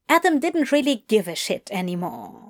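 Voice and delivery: usual speaking voice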